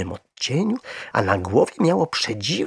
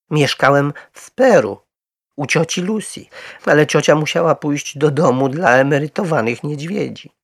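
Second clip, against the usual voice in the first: higher, louder storytelling voice; earnest little bear; 7 semitones higher, 5 dB louder than usual. A narrator's reading. Little bear telling Mr Brown about his aunt, earnest. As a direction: tell your history in a raised bear voice.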